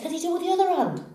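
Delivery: high, fast excited question; eager barman. Acted. Barman asks cowboy for another trick, eager. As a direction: ask it quickly, pitched right up.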